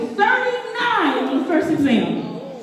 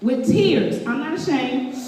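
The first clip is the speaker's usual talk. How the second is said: ordinary delivery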